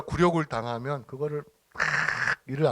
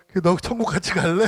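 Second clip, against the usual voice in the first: joking invite; 4 semitones higher, 5 dB louder, faster than usual. Retold or imitated.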